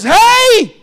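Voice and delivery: a father yelling over his quarreling sons to stop them; father's shout. Acted. Old Jacob cuts into his sons' fight with a loud high shout. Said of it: "Jacob, the wise daddy, steps in".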